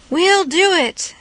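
high, slow cry of agreement; mild crowd shout. A narrator's reading. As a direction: shout back as a crowd, volume held back. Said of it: "they all shouted".

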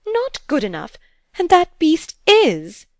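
high, loud, incredulous outcry; furious shout. A narrator's reading. Stepmother outraged at the governess's favor, shouting. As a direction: shout it, stretching the last word. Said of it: "Missus Beale shouted".

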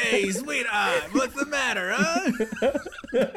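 loud, high New York mobster; silly New York accent. Pretend. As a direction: ham up a New York wise guy, laughing. Doing the Penguin's New York mobster.

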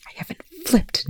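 voiceless, exasperated whisper; whispered denial. A narrator's reading. Telford whispering back at Rush's accusation. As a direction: deny it under your breath.

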